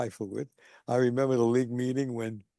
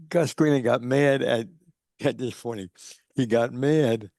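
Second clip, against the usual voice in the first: normal talking voice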